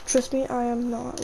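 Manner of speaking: normal talking voice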